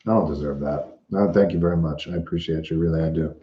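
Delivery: low, soft, moved thanks; genuinely touched, voice dropping low and soft; choked up. Real, not acted. Thanking a generous viewer, low and choked up. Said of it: "I'm emotional as all heck".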